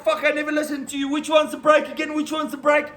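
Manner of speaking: fast, high flustered questions; mock panic. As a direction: panic at the pedals. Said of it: "I'm like".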